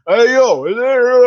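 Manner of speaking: mock Rocky Philly accent, slurred; Rocky mumble. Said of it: "this guy needs subtitles".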